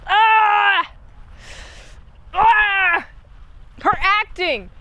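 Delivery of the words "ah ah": frustrated yell. Real, not acted. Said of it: "I'm just like".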